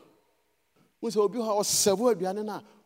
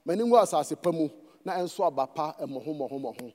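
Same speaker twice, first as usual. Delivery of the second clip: softer, lower prayer voice fading to a hush at amen; closing prayer; 5 semitones lower, 3 dB quieter, faster than usual. Acted. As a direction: pray humbly, drifting down and quieter. Leads closing prayer in Twi.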